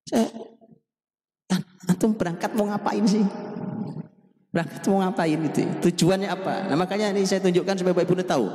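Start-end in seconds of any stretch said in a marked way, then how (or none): 0.1-6.7 high, incredulous rhetorical questions; exasperated questioning. Real, not acted. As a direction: press them on why they go. Preacher questions pilgrims' purpose.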